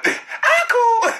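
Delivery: very high, strained "I cool"; squeaky mock reply. Imitating the bloody man, squeaked high. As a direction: squeak it like a hurt guy trying to sound fine.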